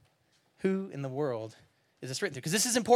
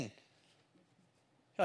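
ordinary delivery

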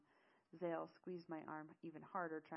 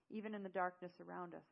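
usual speaking voice

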